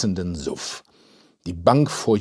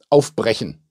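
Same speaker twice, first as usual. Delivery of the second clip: crisp, raised-volume order; commanding, a bit louder; firm command; 5 dB louder than usual. A narrator's reading. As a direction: order it done, no discussion. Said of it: "befahl Luise".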